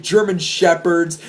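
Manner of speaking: plain reading voice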